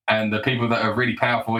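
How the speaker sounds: usual speaking voice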